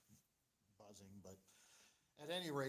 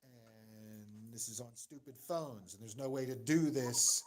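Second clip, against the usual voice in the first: normal talking voice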